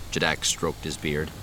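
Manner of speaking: plain reading voice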